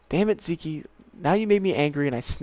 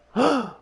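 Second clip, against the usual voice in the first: sharp, high gasp of surprise; excited gasp; 11 semitones higher, 6 dB louder than usual. Real, not acted. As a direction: gasp at the sudden bite. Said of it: "I got excited".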